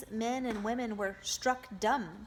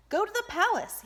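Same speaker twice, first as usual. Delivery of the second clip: high, loud order; commanding; 13 semitones higher, 8 dB louder, faster than usual. A narrator's reading. The boy ordering the crowd to the palace, commanding. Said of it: "he commanded".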